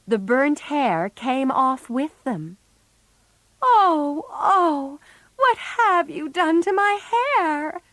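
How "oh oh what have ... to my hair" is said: high, slow wail of horror; horrified cry. A narrator's reading. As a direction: cry out in dismay over ruined hair. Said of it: "cried Meg".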